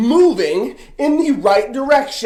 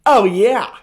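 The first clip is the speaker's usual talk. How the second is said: usual speaking voice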